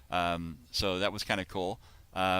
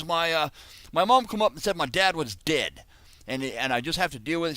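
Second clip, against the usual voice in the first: raised-pitch mock Australian; bad Crocodile Dundee accent; 6 semitones higher, 4 dB louder than usual. [name] recounts his childhood to a stranger, bad Australian accent. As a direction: tell it in badly faked Aussie. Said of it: "this is my very bad Crocodile Dundee impression".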